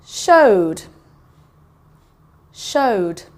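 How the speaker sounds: SHOWED twice, one syllable closing on D; teacher modelling the D ending of showed; D sound at the end. Shown as a demonstration. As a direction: repeat Showed with a long pause, falling tune, ED as D. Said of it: "there's a D sound at the end".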